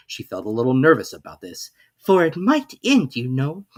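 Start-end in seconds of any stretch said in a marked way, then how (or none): 2.0-3.7 put-on higher, louder, slow worried girl; Alice's voice. A narrator's reading. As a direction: fret to herself. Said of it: "said Alice to herself".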